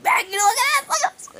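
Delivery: high, loud excited call; excited squeal. Retold or imitated. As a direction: squeal it right in her face. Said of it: "I'm like".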